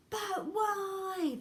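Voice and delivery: high, loud, wailed question; shouting. A narrator's reading. Everyone shouts at Wonka at once, high and loud. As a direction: cry out in protest. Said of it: "they all shouted at once".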